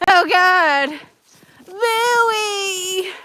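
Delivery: loud, high-pitched holler to a dog; real high, carrying yell; calling out. Real, not acted. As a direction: shout with the last word held long.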